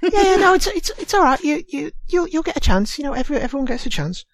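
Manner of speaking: very high, hurried comfort; high-pitched, stammering reassurance; reassuring prison officer. Acted. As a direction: soothe quickly in a high, fussy voice. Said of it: "prison officers comes over and leans into the, um, the young girl".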